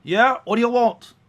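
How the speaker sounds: loud, high, brusque driver's holler; woman carriage driver. Acted. Cab driver answering a stranger's hail, loud high voice. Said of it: "She says".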